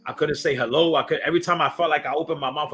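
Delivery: normal talking voice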